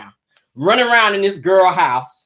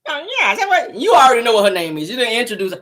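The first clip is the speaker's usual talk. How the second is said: normal talking voice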